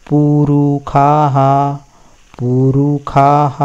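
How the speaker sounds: slow पुरुखाः, ending in a breathy ह echo; slow recitation. Shown as a demonstration. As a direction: read पुरुखाः with the visarga sounded.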